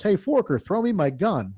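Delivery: calling out high across the campsite for a gun; a half-voiced shout for the gun; retold yell. Friend in the dark yells to him in the tent, high call. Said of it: "and he yells".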